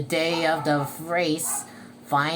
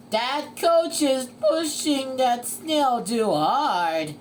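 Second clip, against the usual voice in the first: loud, slow, raised commentator voice; race announcer; 7 semitones higher, 5 dB louder, slower than usual. Acted. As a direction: announce it to the whole crowd. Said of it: "said the announcer".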